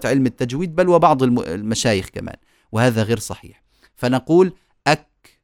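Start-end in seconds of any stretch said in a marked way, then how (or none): 4.9-5.4 "أك" shut, then opened with slight breath; measured "أك" with a small puff after closure; close, then release. Shown as a demonstration. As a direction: stop on the k of "أك", then release it gently. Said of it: "أغلقنا، فتحنا".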